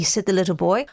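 usual speaking voice

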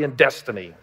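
normal talking voice

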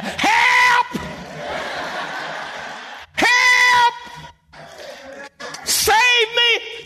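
high shouted cries for rescue; desperate short prayer. Acted. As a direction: yell it as an acted plea for help. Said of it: "He didn't pray a long prayer".

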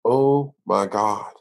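low, slow stunned murmur; hushed, drawn-out disbelief; awestruck. Retold or imitated. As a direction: let it out slowly, stunned. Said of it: "And I'm just like".

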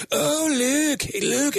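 imitating a high, comic Scottish cry; high Scottish wail, long oo; Scottish accent. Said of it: "You're like".

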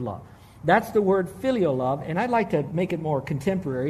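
ordinary talk

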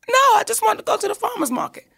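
high, quick mock-tearful plea; imitating a tearful whine at a rush; retold crying protest. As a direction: protest like an upset little girl, lightly acted. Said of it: "I'm full-fledged crying by this point".

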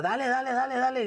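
high, repeated go-go plea; retold urging. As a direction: urge the driver to get moving. Urging the driver to go.

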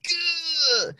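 high panicked groan; jolted rookie, high strained groan; high strained grunt. Retold or imitated. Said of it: "And I'm like".

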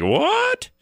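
high-pitched retold "what?" of disbelief; incredulous. Host reacting to mom's news of two cases, incredulous.

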